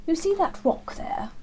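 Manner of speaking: a hint of an eager old hare; Hare's voice. Hare voiced in bedtime story.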